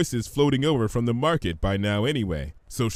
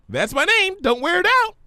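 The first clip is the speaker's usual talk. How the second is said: much higher, louder, bouncy; bubbly Pinky; 7 dB louder than usual. Acted. Pinky teases Fluttershy, high bubbly voice. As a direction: play it giddy and cheeky.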